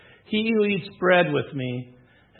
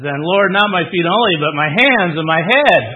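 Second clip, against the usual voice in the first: loud, eager plea for more; eager Peter; 2 semitones higher, 11 dB louder than usual. Acted. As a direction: overeager disciple crying out for more. Peter eagerly asks for more washing.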